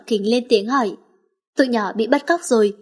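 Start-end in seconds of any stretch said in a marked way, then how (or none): none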